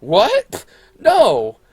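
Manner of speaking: very high, loud fake shock; put-on disbelief. Feigning innocence to the principal, high exaggerated disbelief. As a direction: act scandalized and deny it loudly. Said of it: "I was putting on act".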